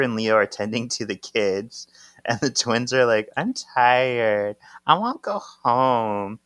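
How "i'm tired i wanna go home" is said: drawn-out kiddie whine; whining children. Retold or imitated. The twins beg to go home, mock whining. Tired twins voiced in a story.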